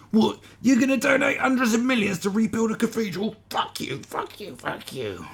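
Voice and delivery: high, loud outraged shriek fading to spent swearing; hammed-up internet outrage; angry online ranter. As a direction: blow up over nothing, then run out of steam. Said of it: "Everyone's an angry short person online".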